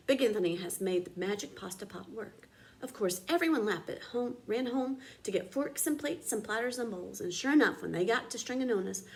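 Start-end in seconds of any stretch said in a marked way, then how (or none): none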